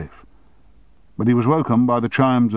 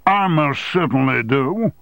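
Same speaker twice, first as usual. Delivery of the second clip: firm, slow insistence; 7 semitones higher, 3 dB louder than usual. Acted. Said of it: "replied Armstrong".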